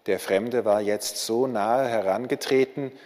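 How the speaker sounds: usual speaking voice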